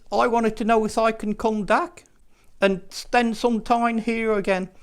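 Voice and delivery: a small puppet asking politely to come back. Acted. Dudley the puppet asks to visit again, timid puppet voice.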